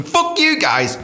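high, playful fake indignation; hammed-up anger; mock outburst. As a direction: jokingly yell at rivals. Mock rivalry with university.

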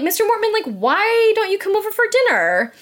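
high-pitched singsong mock politeness; chirpy parents' invitation. Parents to the librarian, mock-friendly high invitation.

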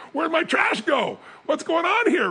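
hammed-up puzzled neighbor calling out; bewildered outcry. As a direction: play a homeowner shouting in confusion. Residents find trash mysteriously gone.

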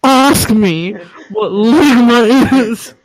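loud, drawn-out insistence; insistent demand. Acted. Pushing a friend to take the bait, loud and drawn out. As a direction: stretch out each word, forceful. Insists again after refusal.

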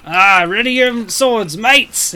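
loud captain's call to arms; rallying shout. Acted. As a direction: rouse the crew.

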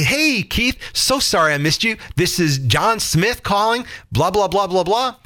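match his over-the-top energy; high-energy callback. Shown as a demonstration. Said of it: "What you need to say is".

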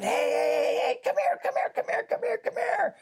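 high, loud calling out to the couple; excited shout across the street to the homeless couple; shouting from the car. Retold or imitated. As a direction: call them over, really hollering. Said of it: "started shouting".

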